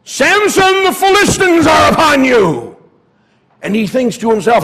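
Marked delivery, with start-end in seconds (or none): 0.0-2.8 high, loud urgent shout; calling out. Acted. Delilah shouting to wake shorn Samson, loud alarm. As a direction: shout the final alarm as if it's real. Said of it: "And Delilah calls out".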